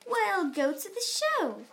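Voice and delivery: high, bright call of delight; excited sing-song. A narrator's reading. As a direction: call out gleefully. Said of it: "She sang out".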